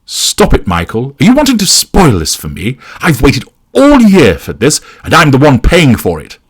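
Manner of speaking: high, loud, upset scolding; angry hiss. A narrator's reading. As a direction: scold him, pitch climbing with frustration. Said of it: "she hisses".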